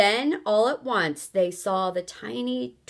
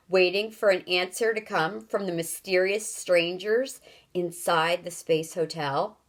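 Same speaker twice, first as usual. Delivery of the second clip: usual speaking voice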